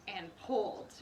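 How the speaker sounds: ordinary delivery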